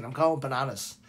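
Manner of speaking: ordinary talk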